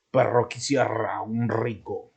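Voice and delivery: lightly put-on German accent, slow; a light jokey German accent; imitating a German speaking Spanish; mock German accent. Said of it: "Pareciera que hablamos como ... como los alemanes".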